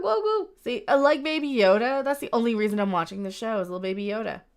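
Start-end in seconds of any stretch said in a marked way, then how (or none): 0.0-0.5 very high cooing name. Real, not acted. Cooing at Grogu.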